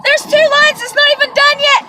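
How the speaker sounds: very high, loud squealing; excited shriek. Retold or imitated. Seeing two lines on the test, excited shriek. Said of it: "I got excited".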